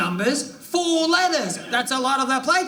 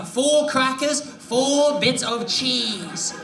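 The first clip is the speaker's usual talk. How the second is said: slow, emphatic; much slower than usual. Acted. Repeated slow listing.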